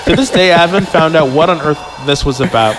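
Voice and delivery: ordinary talk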